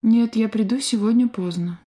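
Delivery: ordinary delivery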